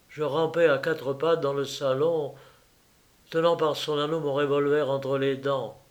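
usual speaking voice

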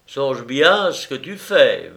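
plain reading voice

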